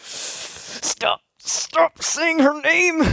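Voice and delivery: scream. Retold or imitated. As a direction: explode at them to stop. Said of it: "I screamed".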